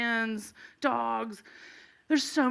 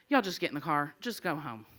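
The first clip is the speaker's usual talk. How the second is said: weary officer; 6 semitones lower, 5 dB quieter than usual. Retold or imitated. Weary officer dismisses them.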